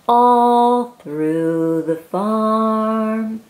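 drawn out slowly; slow refrain. Acted. Reading to a child, slow sing-song refrain. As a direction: stretch each word out.

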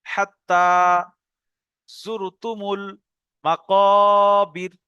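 very slow, word by word with long pauses; spelling it out slowly. Shown as a demonstration. Teacher shows spelling out the next verse, slow halting reading. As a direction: sound out each word separately. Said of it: "বানান করে করে".

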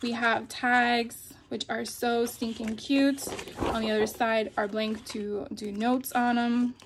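normal talking voice

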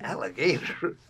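questioning repeat, rising at the end; retold baffled question; puzzled echo. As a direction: echo it back in mock puzzlement. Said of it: "And I said".

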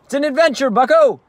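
put-on high and loud, rallying the kid; jubilant cry. Dad rallying toddler son as he drives off, jubilant loud cry. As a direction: shout it like a cheerful battle call. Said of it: "he cries".

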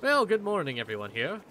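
courteous, lilting welcome; translated Duergar guard. Acted. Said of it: "Faye, as you hear this, you hear clearly".

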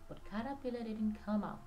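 ordinary delivery

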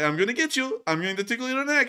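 high, playful little menace; teasing drone. Acted. Drone chasing a soldier it will tickle, high teasing voice. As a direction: taunt it in a high, cutesy voice. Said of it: "And the drone's like".

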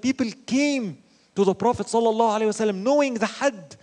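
normal talking voice

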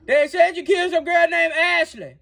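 high-pitched yelled accusation; yelling. Acted. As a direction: repeat it loudly for someone who didn't hear. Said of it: "can you stop yelling at me?".

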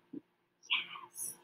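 whispered yes; quiet aside to the class. Real, not acted. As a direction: whisper the praise. Teacher whispers praise.

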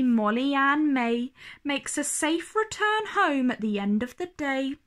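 ordinary delivery

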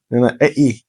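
ordinary talk

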